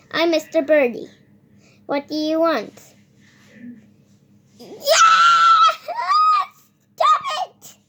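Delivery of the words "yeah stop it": very high, loud squeal with a laugh in it; squealing puppet shout. Acted. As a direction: shriek like a tiny toy being tickled. Puppet squeals in play fight.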